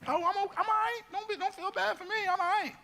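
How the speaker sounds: very high, squeaky put-on voice; mock brave face. Preacher mimicking his reassurance to others, squeaky mock voice. Mock self-pity in acted quote.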